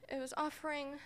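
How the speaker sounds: ordinary talk, nothing marked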